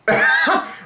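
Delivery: a sudden high giggle; delighted high giggle; high breathy laugh. Real, not acted. Bursts out laughing.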